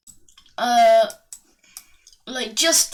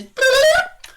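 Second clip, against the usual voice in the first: high, loud rising bloop; imitated bloop; 8 dB louder than usual. Narrator mimics pipe spawning Koopa, high rising bloop. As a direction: trill a spawning noise way up high. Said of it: "and then it goes".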